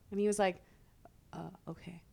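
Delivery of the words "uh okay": whispered, defeated-sounding mock answer; imitated meek reply. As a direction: mimic the chastened ex in a whisper.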